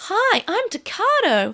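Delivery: high, slow mock-innocent introduction; Takado. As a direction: introduce yourself slowly in a high put-on voice. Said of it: "Takado's just like".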